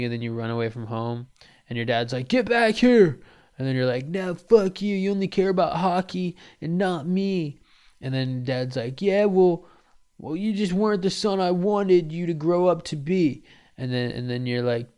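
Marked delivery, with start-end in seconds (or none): 2.3-3.2 high, loud command; shouting dad. Retold or imitated. Dad shouts at runaway son. 4.1-7.6 higher, loud rebellious outburst; angry teen. Retold or imitated. Teen yells at dad. 9.0-13.4 higher, loud cutting retort; harsh dad. Retold or imitated. Dad answers teen harshly.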